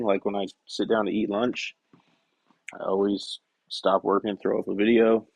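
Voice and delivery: normal talking voice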